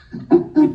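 short breathless laugh; a delighted burst of laughter; real airy laugh, no words. Real, not acted. Laughing at prophetic coincidence.

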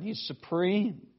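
normal talking voice

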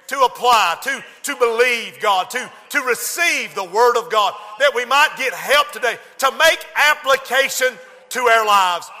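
loud, high impassioned prayer; fervent prayer. Real, not acted. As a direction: plead with God, rising.

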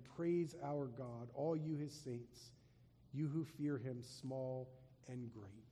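slow, measured, solemn; slow voice from the throne. Acted. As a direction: let each phrase fall slowly.

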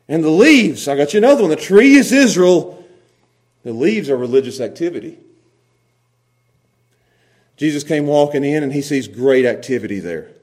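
normal talking voice

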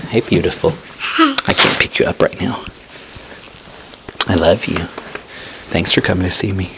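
a loving whisper to a small visitor; tender aside. Real, not acted. Greets a child tenderly.

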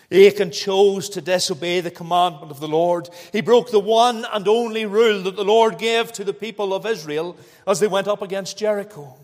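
loud, ringing sermon voice; preaching. Acted. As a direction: declare it from the pulpit. Preaching at full force.

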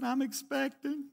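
small, quiet, drawn-out mock-tearful voice; imitated sobbing. Tearful wife breaking pregnancy news to husband, imitated sobbing. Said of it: "she's just sobbing".

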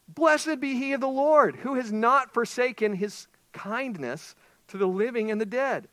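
high, loud, slow joyful blessing; excited Naomi. Acted. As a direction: proclaim it with joy. Naomi voiced, reading scripture.

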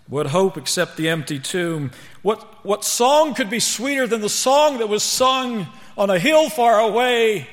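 building to a high, loud sermon climax; preach. Real, not acted. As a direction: let the questions swell and soar. Preaches at full force.

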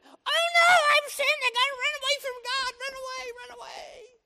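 shrill, very high mock panic; panicked sinner. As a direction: squeal in mock terror. Sinner fleeing God.

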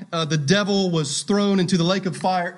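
"thrown" leicht angehoben und betont; stress on thrown. Shown as a demonstration. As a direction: lean into the word "thrown". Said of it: "Emphasis in that phrase on the word thrown".

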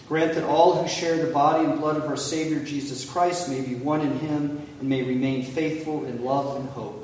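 normal talking voice

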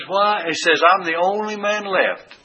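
sulk, feeling all alone; self-pitying whine. Retold or imitated. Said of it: "this pouting pity party".

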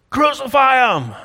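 high, loud demand to crucify; mob shout. Acted. Preacher voicing the mob answering Pilate, a loud high yell. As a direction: yell it like an angry crowd.

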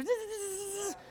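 high, quiet buzzing whine; dentist drill. Retold or imitated. Preacher reminds the church of the dentist, drill noise. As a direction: imitate a dentist's drill with a long zzz. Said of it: "Kinda like a dentist".

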